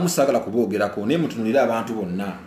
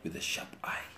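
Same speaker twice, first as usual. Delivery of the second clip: unvoiced whisper of the phrase; 13 dB quieter than usual. Shown as a demonstration. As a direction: hush it like a secret. Repeats phrase as whisper then voiced.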